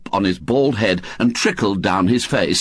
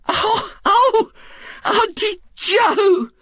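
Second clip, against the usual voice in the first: much higher, slow shaken cry; gasping; much slower than usual. Acted. Mrs Bott sees Aunt Jo emerge, gasping. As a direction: gasp out her name in shock. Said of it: "gasped Missus Bott".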